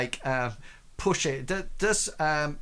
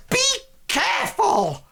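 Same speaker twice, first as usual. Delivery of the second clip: loud, high-pitched merchant exclamation; merchant's warning cry; 6 dB louder than usual. Acted. Merchant warning the crate pushers, loud high alarm. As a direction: call out a sharp warning as the merchant. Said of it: "this merchant says".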